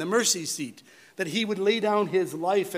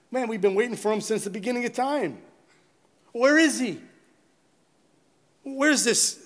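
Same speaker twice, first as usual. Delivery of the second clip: higher and louder, mocking impatience; scoffing mockers; 7 semitones higher, 4 dB louder than usual. Preacher voicing scoffers who doubt God's coming, mocking and loud. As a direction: taunt like a scoffer who's tired of waiting. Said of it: "You remember hearing the statement?".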